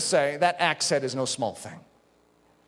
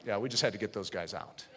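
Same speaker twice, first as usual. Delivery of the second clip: offhand church member; 10 semitones lower, 11 dB quieter than usual. Retold or imitated. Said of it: "it's like".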